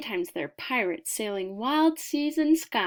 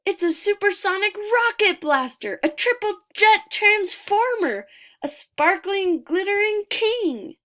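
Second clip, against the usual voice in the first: much higher and louder, bursting with wonder; breathless delight, higher and louder throughout; wild excitement; 7 semitones higher, 8 dB louder than usual. A narrator's reading. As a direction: read it like the best thing a child ever saw. The amazing box on wheels.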